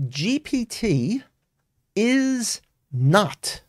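IS NOT drawn out slowly, each word set apart; emphatic. Real, not acted. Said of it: "I would emphasize this, and I would raise my voice".

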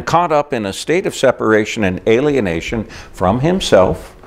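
normal talking voice

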